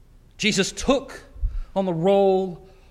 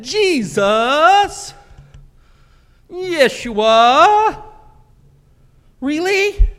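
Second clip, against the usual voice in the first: high, loud disbelieving whine; whining; 10 semitones higher, 6 dB louder, much slower than usual. Retold or imitated. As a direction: complain to Jesus like it's impossible. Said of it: "They whined".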